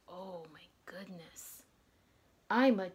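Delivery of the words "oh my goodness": unvoiced whisper, hushed; soft whispered oh my goodness; whispered awe. Shown as a demonstration. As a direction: breathe it out in wonder. Reacting to angry picture.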